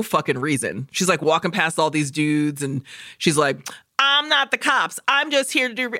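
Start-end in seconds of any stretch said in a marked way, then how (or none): 4.0-6.0 higher, mock-defensive insistence; mock tough researcher. Researcher brushing off the dudes in a mock-defensive voice. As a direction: play her brushing them off, lightly.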